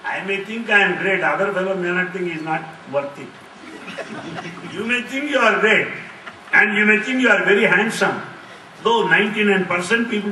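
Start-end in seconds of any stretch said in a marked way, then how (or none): none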